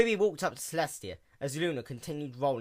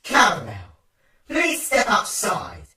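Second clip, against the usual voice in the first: loud, slow, menacing call; hammy stage actor; 8 semitones higher, 13 dB louder, slower than usual. A narrator's reading. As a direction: summon him out with slow menace. Menacing voice calls him out.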